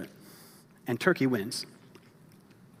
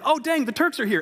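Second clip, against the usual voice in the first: much higher, louder panicked cry; mock panicked yell; 13 semitones higher, 7 dB louder than usual. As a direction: yell it like a spooked soldier. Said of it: "started yelling".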